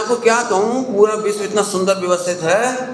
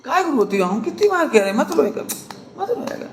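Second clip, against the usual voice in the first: scolding mother; 6 semitones higher, 4 dB quieter than usual. Retold or imitated. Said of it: "अब उसे गले में लगा".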